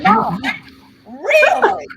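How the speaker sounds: loud, high, incredulous squeal; rising, stretched-out protest; mock disbelief. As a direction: act out an appalled daughter questioning mama. Daughter disbelieves mother's thrift find.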